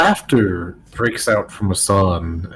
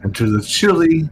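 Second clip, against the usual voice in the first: hushed narration; 4 semitones lower than usual. A narrator's reading. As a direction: let each phrase hang in the cold air. GM slow atmospheric narration.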